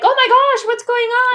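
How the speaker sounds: high, loud panicked gasp of alarm; mock freaking out. Retelling how a friend could have panicked, a hammed-up high loud freak-out. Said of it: "Instead of freaking out".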